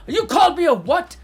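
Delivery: high, loud indignant question; mock outrage. Partner overhearing the venting, loud mock outrage. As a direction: blow up in pretend disbelief.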